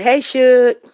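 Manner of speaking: loud, bright call to the dog; calling out Shook. Retold or imitated. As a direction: sing out his name. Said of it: "Cherie said".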